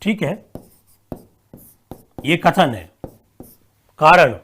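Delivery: dictating word by word with long pauses. Acted. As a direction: read the question out for students to write. Dictates a question slowly.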